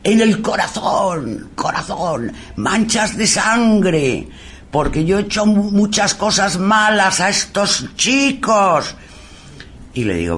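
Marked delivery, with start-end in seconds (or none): 0.0-8.9 guilt-ridden priest. Retold or imitated. Guilty priest speaking through medium.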